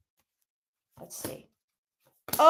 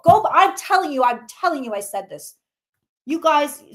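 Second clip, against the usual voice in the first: usual speaking voice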